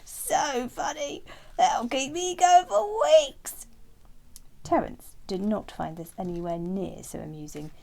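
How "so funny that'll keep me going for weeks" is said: slow, high, loud frog voice, gleeful. Acted. Frog gloating over Terence's fright, slow high loud glee. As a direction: savour the joke as a frog.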